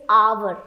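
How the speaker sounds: "hour" in two syllables, ah-vuh; correct pronunciation demo. Shown as a demonstration. Teacher giving the right pronunciation, correct pronunciation demo. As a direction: say "hour" with a v-like glide in the middle. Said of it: "the right pronunciation is".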